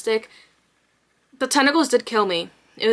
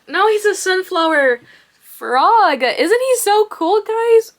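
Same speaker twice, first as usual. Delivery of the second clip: excited; 8 semitones higher, 4 dB louder than usual. Real, not acted. Showing off finished frog.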